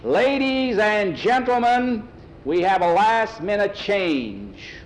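slow, high, loud public call; drawn-out racetrack announcement; megaphone announcer. Acted. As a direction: stretch every word out over a crowd. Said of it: "they heard on the megaphone".

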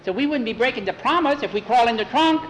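ordinary delivery, nothing marked

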